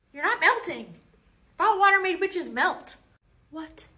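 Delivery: normal talking voice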